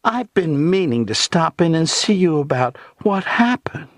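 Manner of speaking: high, falsely embarrassed burlesque; venomous simpering. A narrator's reading. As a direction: simper with fake politeness and hidden venom. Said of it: "said then in a simpering voice".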